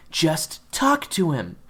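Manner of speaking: P=A; insistent repeat. Acted. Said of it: "she repeated".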